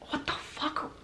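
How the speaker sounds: high, breathy whispered outburst; hushed, incredulous exclamation; appalled whisper. Real, not acted. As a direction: whisper it in utter disbelief. Appalled reaction to the deep-fried head.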